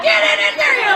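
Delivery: loud, high gruff dad command; shouting dad; dad barking an order. Retold or imitated. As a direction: bark it like an impatient father. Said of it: "He's like".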